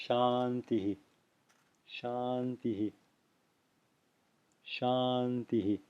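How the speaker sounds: softly said Shantihi, long first syllable, light hi; quiet, slow model of "Shantihi"; short soft echo. Shown as a demonstration. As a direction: let the final hi of Shantihi be a brief breathy echo. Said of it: "it's short and it's soft".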